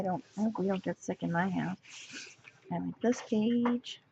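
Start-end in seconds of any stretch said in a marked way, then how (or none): none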